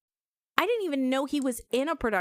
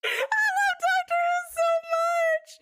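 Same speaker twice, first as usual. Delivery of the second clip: higher, louder, slow fangirl squeal; excited gushing; 4 dB louder, slower than usual. Real, not acted. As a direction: squeal it out with pure joy. Gushing fan at game start.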